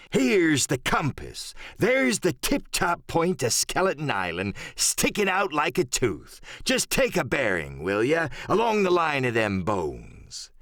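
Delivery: slow, drawn-out sea-cook voice; Silver, shrewd pirate leader. Acted. Silver ordering his men to take a bearing, slow pirate voice. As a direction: stretch the words like an old pirate captain.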